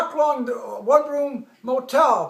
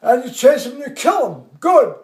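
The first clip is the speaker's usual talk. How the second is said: loud oratory building to a mock-outraged punchline; colonel's speech; 2 semitones higher, 4 dB louder than usual. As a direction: imitate a commander haranguing his troops. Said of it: "the colonel's there".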